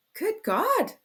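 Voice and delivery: sharp high cry of alarm; a sudden high alarmed cry. A narrator's reading. Mother alarmed as her son leaves, high cry. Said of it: "cried Pulcheria Alexandrovna".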